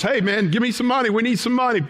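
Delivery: high, fast, pushy beggar voice; beggar asking for money. Retold or imitated. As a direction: rattle it off like a street beggar begging. Beggar voiced in retold story.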